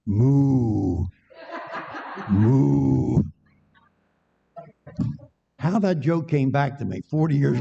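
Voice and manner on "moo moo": long, drawn-out moo at speaking pitch; imitated cow moo. City kid up a tree, badly judged animal disguise, mooing. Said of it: "The kid from New York goes".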